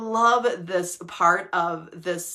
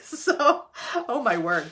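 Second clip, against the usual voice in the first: laughing; 3 semitones higher, slower than usual. Real, not acted. Laughing at her own rush.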